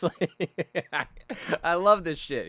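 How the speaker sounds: high, giggling through the words; laughing. Real, not acted. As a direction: crack up while saying it. Laughing at his own quote.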